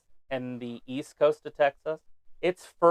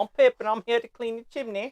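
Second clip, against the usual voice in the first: put-on higher-pitched comic London chimney-sweep accent; mock London accent; 7 semitones higher than usual. Friend playing Pip come to clean the chimney, mock London accent. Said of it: "Londonish type accents".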